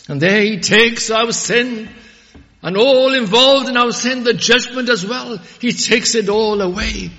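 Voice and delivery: loud, high, ringing sermon voice; full-force pulpit preaching; fiery preaching. Acted. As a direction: proclaim it to the whole congregation.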